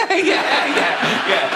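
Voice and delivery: laughing, hammed-up jolly agreement; jolly fat person. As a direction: play a jolly soul chuckling along. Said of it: "They're jolly".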